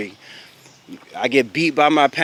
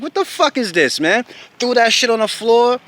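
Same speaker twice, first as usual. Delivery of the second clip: high, heated outcry of disbelief; retold angry outburst; 9 semitones higher than usual. Student retelling the moment he stormed out, high angry disbelief. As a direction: throw the question out high and furious. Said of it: "Pissed, yo".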